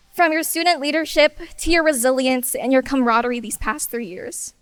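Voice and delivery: usual speaking voice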